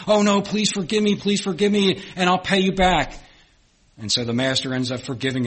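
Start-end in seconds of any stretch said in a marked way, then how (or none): 0.1-3.1 high, louder pleading debtor; groveling plea. Acted. Debtor grovels before the master, high desperate begging. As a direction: beg on your knees for mercy. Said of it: "grovels and says".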